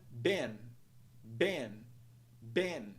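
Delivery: "been" like "Ben", short E; isolated falling model of "been", short vowel; one whole syllable. Shown as a demonstration. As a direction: say "been" in one piece, rhyming with "ten". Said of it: "palavra por inteiro".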